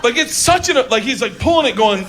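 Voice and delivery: ordinary delivery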